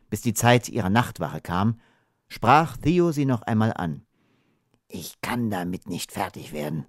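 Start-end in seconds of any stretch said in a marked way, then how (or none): 4.9-6.9 soft, troubled murmur; hushed, subdued admission; quiet confiding. A narrator's reading. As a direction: confide it softly at bedtime. Theo confides at bedtime, troubled.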